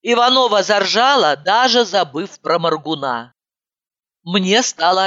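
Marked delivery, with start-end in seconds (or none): none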